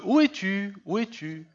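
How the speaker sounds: God playing hide and seek with Adam; fading calls of where are you; mock searching call. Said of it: "il rentrait dans son jeu".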